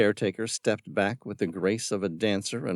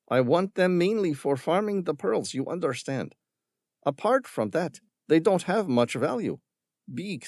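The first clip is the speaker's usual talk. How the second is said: higher, falsely grateful villain voice; Ramirez, smooth villain; 10 semitones higher than usual. A narrator's reading. Villain feigning thanks to the boys. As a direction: thank them with hollow politeness.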